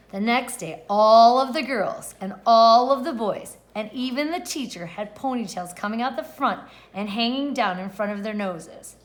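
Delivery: ordinary delivery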